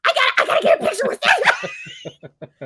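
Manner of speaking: high squealing plea through laughter; laugh-shout it like a starstruck fan; excited laughing squeal. Retold or imitated. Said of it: "I start shaking Dave".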